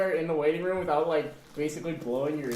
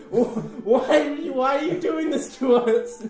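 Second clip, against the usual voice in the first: high, loud laughing despair; laughing plea; 9 semitones higher, 6 dB louder, slower than usual. Retold or imitated. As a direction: beg while cracking up. Retold desperate plea.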